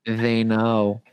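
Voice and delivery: low, drawn-out warning; mock ominous. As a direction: intone it like a creepy omen. Spooky running joke about ikea.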